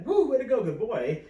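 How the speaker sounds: whooping praise, high and loud; high-pitched, loud cheer for the dog; excited dog praise. Shown as a demonstration. Trainer showing the yes tone to a dog, excited whooping praise. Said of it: "when he hears that tone".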